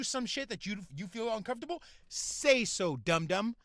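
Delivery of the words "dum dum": ordinary talk, nothing marked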